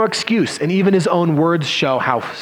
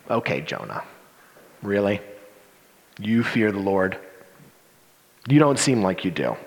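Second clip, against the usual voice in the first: softer, dry sarcastic aside; sarcastic disbelief; 8 semitones lower, 6 dB quieter than usual. Acted. Preacher doubting Jonah's claim to fear God, softly sarcastic. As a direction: needle him with quiet sarcasm. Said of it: "snark and sarcasm coming out".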